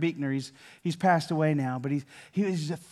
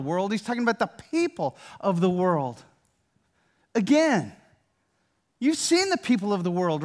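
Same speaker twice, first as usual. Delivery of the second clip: louder and higher, driving each point; full-force preaching; 4 semitones higher, 5 dB louder than usual. Acted. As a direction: press the congregation hard.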